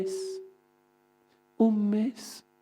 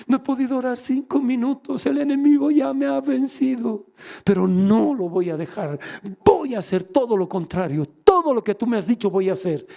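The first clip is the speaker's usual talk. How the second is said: raised voice building into defiance; impassioned retold cry; 6 semitones higher, 4 dB louder than usual. He vows to God to defy the enemy, impassioned. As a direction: cry out, then punch out the vow.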